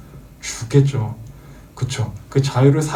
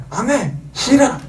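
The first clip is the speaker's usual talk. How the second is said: higher, bratty no-no; defiant toddler; 9 semitones higher, slower than usual. Retold or imitated. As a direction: shout it like a stubborn small child. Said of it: "애들한테 뭔 말하면 뭐라고 하죠?".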